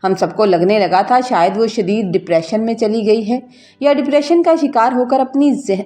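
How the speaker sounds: ordinary delivery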